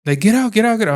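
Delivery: high, urgent yelling to chase it off; trying to sound big and tough, shouting it away; retold scream. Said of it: "I'm just screaming at her".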